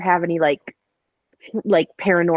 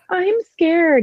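put-on little kid voice; 11 semitones higher than usual. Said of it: "go down and be like".

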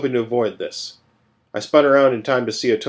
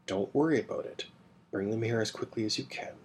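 much softer, quick secret instruction; hushed orders; 12 dB quieter than usual. Acted. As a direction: keep it down so the others can't hear. Secret orders at dinner table.

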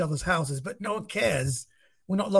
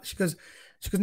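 ordinary talk

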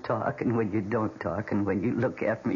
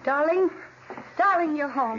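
excited greeting. Acted. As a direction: call out to him, thrilled.

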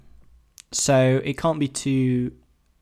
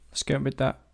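normal talking voice

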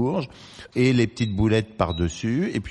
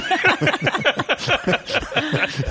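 high laugh. Real, not acted. Joking about boyfriend's nonsense.

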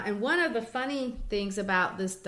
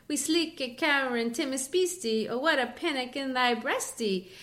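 light American attempt at a Scottish accent; lightly voiced Scottish reading, rolling the rhythm; 4 semitones higher than usual. Shown as a demonstration. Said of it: "try to read it in American Scott".

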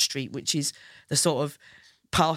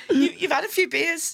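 higher, laughing start; 10 semitones higher than usual. Real, not acted. Laughing as she starts the poutine truck story. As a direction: giggle the opening.

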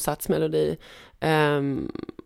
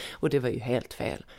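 ordinary talk, nothing marked

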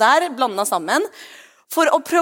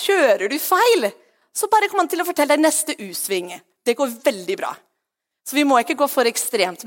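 normal talking voice

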